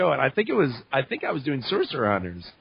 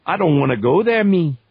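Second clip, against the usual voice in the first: louder Cajun demo, tagged-on "me"; Cajun accent; 4 semitones higher, 5 dB louder than usual. Shown as a demonstration. Teaching the Cajun accent, loud Louisiana Cajun example. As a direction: throw the Cajun accent to the back of the throat. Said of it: "You know, like that".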